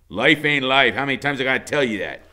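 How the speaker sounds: loud, quick; impatient repeat; exasperated. A narrator's reading. Iggy exasperated with Loretta, loud and fast.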